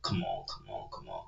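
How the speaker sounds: whispered in disbelief; awed whisper. Real, not acted. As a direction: breathe it out, floored by the line. Stunned by the lyric.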